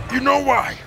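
intense hype. Real, not acted. Pushing through the set.

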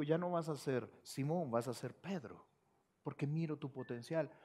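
low, soft and gentle; hushed, loving promise; tender Jesus. Acted. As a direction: speak to Peter with quiet love. Jesus speaks to Peter.

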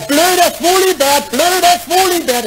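sing-song taunt. Acted. Schoolkids jeering again under his window. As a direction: chant it like mocking kids.

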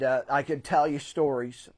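ordinary talk

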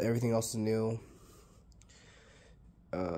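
ordinary talk, nothing marked

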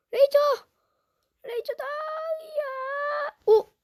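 very high excited cry; excited squeal. Real, not acted. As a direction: squeal with delight. Excited at the laser. (신난 환호성)

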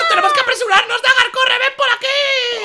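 high-pitched, panicky hurrying; urgent alarm. Acted. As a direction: rush a friend away from danger. Huyen del supuesto ataque. (urgencia aguda y alarmada)

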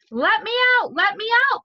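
high, loud call to be let out. Acted. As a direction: call urgently from inside the oven. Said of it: "called a voice from inside the oven".

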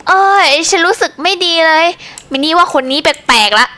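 ordinary talk

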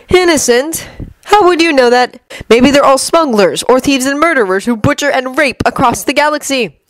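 mock his naivety; sneering Loki. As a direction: Loki scoffing at Stark. Loki voiced by reader.

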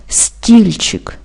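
"Стільчик" with emphatic first syllable "Стіль"; stress on first syllable. Shown as a demonstration. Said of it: "Перший склад в цьому слові теж наголошений". («Стільчик» з наголосом на першому складі)

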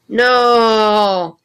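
loud, high cry of "No!"; anguished shout. Acted. Senator cat hears the convention is canceled, loud anguished no. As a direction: wail at the bad news.

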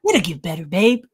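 low-pitched soothing babe voice; imitating a boyfriend. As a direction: drop it low like a consoling man. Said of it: "his voice wasn't like that".

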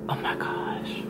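breathless whispered oh my gosh. Real, not acted. Cursing the challenge inventor, whispered dismay. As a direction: whisper it under your breath.

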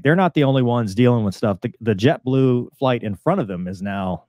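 ordinary talk, nothing marked

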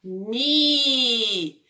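"mi" drawn out long on its own; isolated syllable. Shown as a demonstration. Teacher answering which syllable starts the word, "mi" stretched out. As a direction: hold the vowel of "mi". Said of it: "ele começa com a sílaba".

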